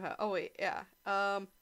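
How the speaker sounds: normal talking voice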